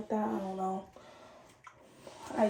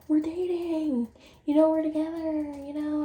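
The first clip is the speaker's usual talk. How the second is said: put-on girlfriend's whiny protest; 5 semitones higher, 4 dB louder than usual. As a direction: imitate a partner pleading, stretched and louder.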